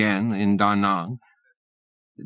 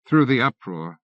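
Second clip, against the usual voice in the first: plain reading voice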